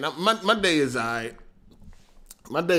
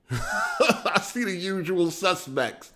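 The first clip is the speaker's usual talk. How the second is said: laughing; 6 dB quieter, much slower than usual. Real, not acted. Repeats greeting laughing.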